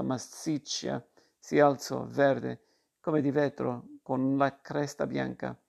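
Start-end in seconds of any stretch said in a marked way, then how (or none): none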